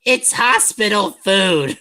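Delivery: a patient whining at his visitor over dinner; whining complaint. Acted. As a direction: whine it like a sulky child. Whining about hospital food.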